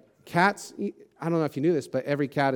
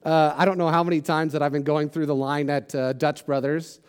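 usual speaking voice

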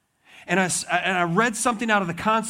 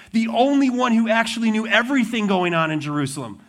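usual speaking voice

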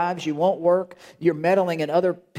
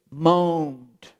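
moaning. Shown as a demonstration. People moaned.

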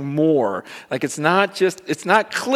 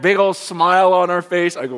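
usual speaking voice

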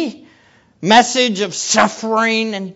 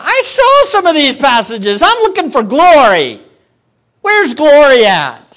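higher, louder, protesting "where's glory"; disappointed disciple; 4 semitones higher, 5 dB louder than usual. Acted. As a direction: play the let-down disciple who wanted glory.

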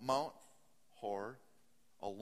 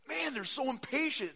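higher, louder exasperated sigh of a parent; 11 semitones higher, 6 dB louder than usual. Retold or imitated. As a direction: throw up your hands at the kids. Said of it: "I just go".

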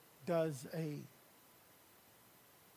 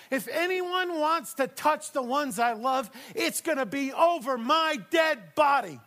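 higher, louder, impassioned preaching; preaching at full force; 9 semitones higher, 11 dB louder than usual. Acted. Pastor rousing the congregation, impassioned shouting preach. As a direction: thunder it like a fierce mother hen.

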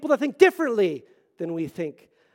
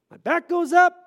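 mock bristling; 11 semitones higher, 9 dB louder than usual. Describing bristling at correction.

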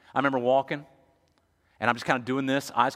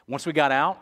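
ordinary talk

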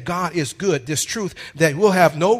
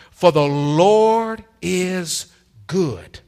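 slow, louder, stretched Lord then dropped good; drawn-out proclamation; 4 dB louder, much slower than usual. Acted. Preacher proclaiming the psalm to the church, stretched loud. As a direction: hold the Lord long, then land good. Repeats the verse.

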